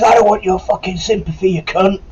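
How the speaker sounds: high, harsh imitation of the corporal; snarling corporal impression. The corporal rejecting the recruit's sympathy, high and harsh. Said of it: "He says".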